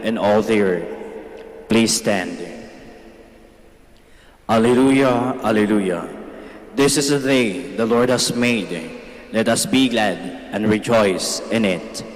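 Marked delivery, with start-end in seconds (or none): none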